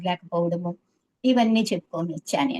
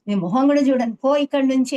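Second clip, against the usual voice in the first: louder retold scolding, pitch rising toward "పో"; angry dismissal; 4 semitones higher, 6 dB louder than usual. Teacher furiously sending the student out of his sight. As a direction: send her away in a burst of temper. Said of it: "అప్పుడు తిట్టారు ఆయన నన్ను".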